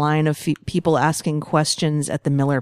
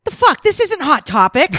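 put-on high, loud, indignant girl voice; imagined teenage girl; 11 semitones higher, 7 dB louder than usual. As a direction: play an outraged comic-shop customer, pitched way up. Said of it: "I'm just picturing this poor girl".

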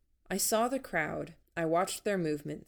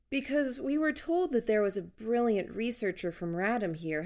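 higher, gushing fake-eager voice; effervescent; 6 semitones higher than usual. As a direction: lay the bubbly charm on thick. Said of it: "practically effervescent in attitude".

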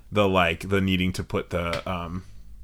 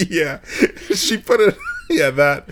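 high, loud laughing through the words; laughing while trying to quote grandma; 4 dB louder than usual. Real, not acted. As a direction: crack up mid-quote.

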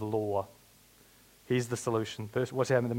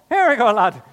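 loud, high leprechaun Irish with a laugh; comic leprechaun accent; 10 semitones higher, 13 dB louder than usual. Pretend. Preacher jokes about his slipping accent, hammed-up Irish leprechaun. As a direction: ham up a leprechaun brogue. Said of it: "Everything goes leprechaun".

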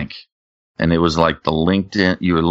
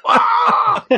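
very high-pitched yelp of shock; retold shocked scream. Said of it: "I screamed out".

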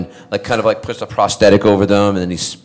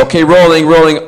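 imitated crew call; 5 semitones higher, 5 dB louder than usual. Film crew calling out the take.

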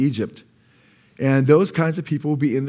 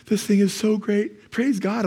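gushing reviewer; 7 semitones higher, slower than usual. Retold or imitated. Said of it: "some are like".

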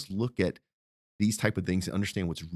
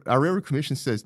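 normal talking voice

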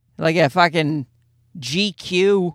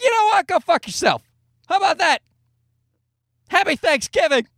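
pitched-up sarcastic yell at his wife; mock angry outburst; 10 semitones higher than usual. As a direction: snap back in high, mock outrage, then shout "Go fuck yourself". Retorting angrily at wife.

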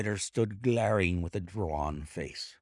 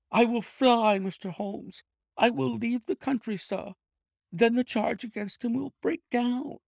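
high, loud frightened begging; panicked clerk begging for mercy; desperate thief pleading; 4 dB louder than usual. A narrator's reading. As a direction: plead with the detective not to prosecute. Ryder's pleading voice held.

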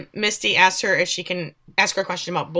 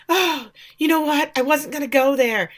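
higher-pitched acted sigh of realization; imagined contrite reaction; 7 semitones higher than usual. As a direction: play at backing down, pitched up. Imagined apology, waited for.

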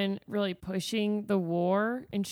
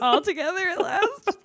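laughing gush; 10 semitones higher, 4 dB louder than usual. Real, not acted. Giggly fan moment.

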